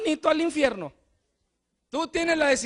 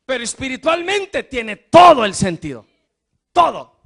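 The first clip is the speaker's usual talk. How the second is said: predicación enérgica; 11 dB louder than usual. Acted. Preaching at full force.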